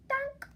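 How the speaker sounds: loud high donk; bonk sound. A narrator's reading. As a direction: pop it out like a cartoon hit. Tusk hits head sound.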